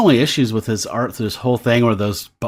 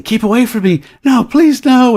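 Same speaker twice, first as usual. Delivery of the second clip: higher, louder pleading scream; a hammed-up cry of terror; mock terrified scream; 12 semitones higher, 7 dB louder than usual. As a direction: beg for your life in a high yell.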